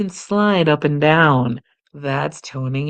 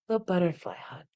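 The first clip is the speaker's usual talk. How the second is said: soft naming; 2 semitones higher, 11 dB quieter than usual. Shown as a demonstration. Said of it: "This is called the butterfly".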